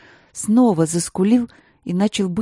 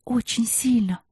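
soft coaxing; 6 semitones higher, 4 dB quieter than usual. Acted. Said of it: "уговаривать его".

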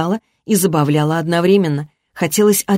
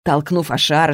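ordinary delivery, nothing marked

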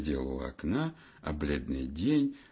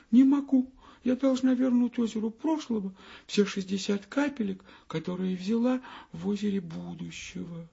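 high, small pleading voice; little minute refusing the hour; Minutka's tiny voice; 13 semitones higher than usual. Acted. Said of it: "Минутка".

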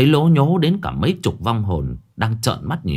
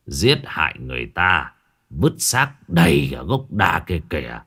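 unhurried, measured; 5 semitones lower than usual. Acted. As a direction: explain it low and unhurried, like a grave elder. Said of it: "cụ chỉ thủng thẳng". (giọng trầm, thủng thẳng)

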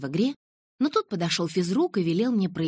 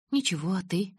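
ordinary delivery

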